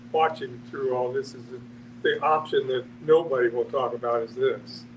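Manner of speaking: usual speaking voice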